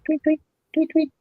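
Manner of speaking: high, soft mock bird tweets; bird chirps. Imitates birds after lovebird talk.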